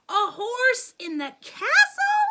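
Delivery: high, loud shocked shriek; queen screaming. A narrator's reading. Queen screaming at the horse in the hall. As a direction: shriek in outrage. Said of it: "the queen screamed".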